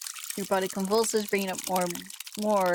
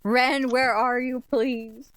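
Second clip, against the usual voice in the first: loud, slow call for Ren; searching for Ren, calling slowly; 4 semitones higher, 5 dB louder, much slower than usual. Acted. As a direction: call out anxiously across the house.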